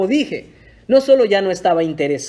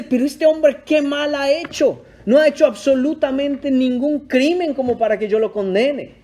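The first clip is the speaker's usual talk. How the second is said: protesting Pilate; 6 semitones higher than usual. Retold or imitated. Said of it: "mira esta situación y dice".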